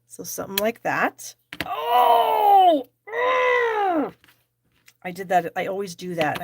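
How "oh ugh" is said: high, loud wail of dismay; frustrated cry. Real, not acted. Crafter spoils her own stamping, frustrated cry. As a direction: cry out, furious at your own slip. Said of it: "I'm so mad at myself".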